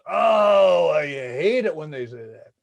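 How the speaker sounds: loud exasperated groan into a complaint; group groan. Retold or imitated. Students complaining about their parents' happiness line: loud exasperated groan. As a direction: groan loud like fed-up teens.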